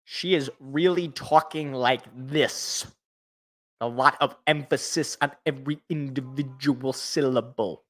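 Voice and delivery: slow, every syllable pressed out like a sideline reporter; gently mocking a reporter's crisp diction; mock over-enunciation. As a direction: lightly mimic Pam Oliver's careful diction. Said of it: "really enunciating all of her words".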